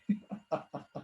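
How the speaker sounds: quiet breathy chuckle; a little knowing laugh; soft laugh. Real, not acted. Teacher laughing softly at the tricky point.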